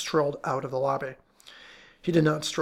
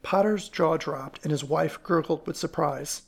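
ordinary delivery, nothing marked

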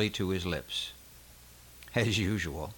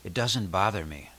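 plain reading voice

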